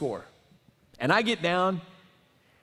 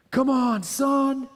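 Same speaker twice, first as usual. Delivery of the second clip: high, loud cheering yell, slowing at the end; imitating a dad bellowing from the bleachers; dad yelling from the stands; 8 semitones higher, 3 dB louder, slower than usual. Dad cheers his son's team from the stands, mock yelling high and loud. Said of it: "you could hear him from a mile away".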